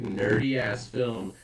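ordinary talk

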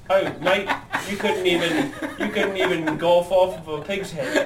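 laughing mock Australian insult, high; a put-on Australian mate jeering through laughs; mock Australian accent. Australian accent jeering at the Newcastle grad. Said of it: "It is good accents".

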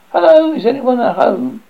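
high, loud hail across the yard; calling out. A narrator's reading. Narrator hails the deserted farmhouse, calling out high and loud. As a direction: shout it to a house that may be empty.

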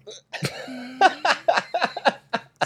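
big high burst of laughter; loud high laugh. Real, not acted. Laughs at own joke.

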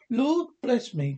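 put-on a shocked old gentleman exclaims; startled cry. A narrator's reading. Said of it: "cried the gentleman".

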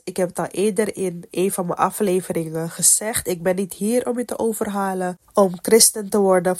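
normal talking voice

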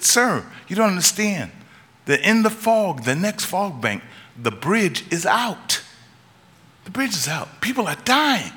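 high, urgent, panicked shout; frantic warning yell. Retold or imitated. Stranger warning the driver that the bridge is out, frantic high yell. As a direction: cry the warning like lives depend on it. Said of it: "yelling and screaming".

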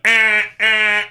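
two loud, high honking blares; imitated car horn. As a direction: blare like an impatient driver's horn. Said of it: "you know, on the horn".

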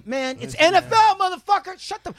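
high, exasperated retort; exasperated outburst. Real, not acted. Answering a friend's silly question in disbelief. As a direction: snap back at the dumb question, way up.

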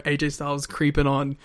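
ordinary delivery

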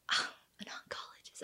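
whispered mock awe; sarcastic whisper. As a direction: breathe it out, unconvinced. Friend mocking his doctor claim.

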